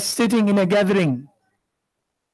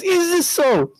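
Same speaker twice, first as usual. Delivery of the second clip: even higher, defiant question as the turban hits the floor; defiant challenge; 9 semitones higher, slower than usual. Retold or imitated. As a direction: throw it down and demand it. Shaykh throws the imama down.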